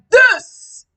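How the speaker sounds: loud, heavy booming demo. Shown as a demonstration. Teacher showing how the baby talks now, booming. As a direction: boom out the words. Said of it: "With a booming voice".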